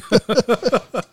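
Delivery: high, breathy ghost boo; a practiced ghostly boo call; spooky boo. Shown as a demonstration. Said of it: "Been practicing that for decades".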